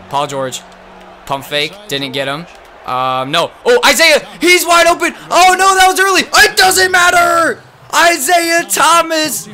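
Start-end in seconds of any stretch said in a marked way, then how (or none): 3.6-9.5 much higher, loud commentary yell; excited announcer shout. Real, not acted. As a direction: scream the name like a buzzer call. Yelling live play-by-play.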